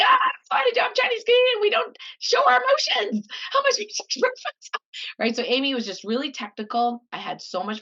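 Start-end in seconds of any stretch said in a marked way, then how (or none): 0.0-4.7 very high, loud mock shrug; mock breezy dismissal. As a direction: brush it off, hammed-up. Mocking her own younger self.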